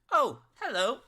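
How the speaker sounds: very high, loud robot greeting; little automaton. Acted. Wee automaton looking up at players, high robot voice. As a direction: chirp it high like a small robot. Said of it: "the automaton".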